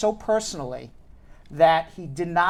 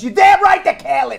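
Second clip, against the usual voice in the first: loud, raised indignant rant; indignant outburst; 7 semitones higher, 9 dB louder than usual. Acted. Customer ranting about careless dry cleaners, indignant outburst. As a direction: shout it in comic outrage.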